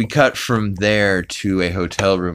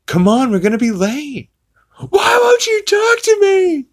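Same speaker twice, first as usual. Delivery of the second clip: much higher and louder, begging the doll; high pleading Peter; 6 dB louder than usual. Retold or imitated. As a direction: plead with a doll that won't answer, voice cracking high.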